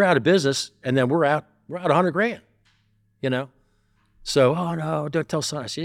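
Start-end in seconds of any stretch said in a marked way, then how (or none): 4.5-5.6 higher, whiny begging; pleading partner. Retold or imitated. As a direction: beg like a caught kid.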